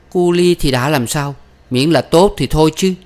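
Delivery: high, protesting voice; defiant daughter. Acted. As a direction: argue back for the lover. Daughter argues back.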